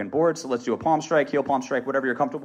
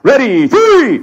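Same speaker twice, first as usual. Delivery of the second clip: stretched, high shouted count; full-power yell; 9 semitones higher, 14 dB louder, much slower than usual. Shown as a demonstration. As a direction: bellow the count at maximum. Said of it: "bring it up to a one hundred percent".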